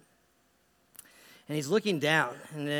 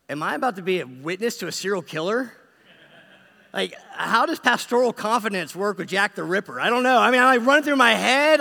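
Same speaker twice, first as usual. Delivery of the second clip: high, loud comic alarm, building to a near-yell; mock panic; 5 semitones higher, 8 dB louder than usual. Pastor privately panicking at a possible killer's confession, mock alarm. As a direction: play up the panicked inner monologue.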